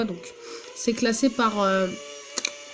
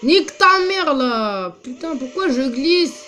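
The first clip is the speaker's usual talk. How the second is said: shouted curse, pitch leaping up; angry outburst; 3 semitones higher, 4 dB louder than usual. Real, not acted. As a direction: snap at the game in frustration. Racing game, sliding off track.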